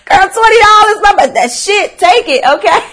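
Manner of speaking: high and loud through real laughter; barely holding it together while laughing; laughing hard. Real, not acted. Telling the girls about mom's twenty dollars, laughing high and loud.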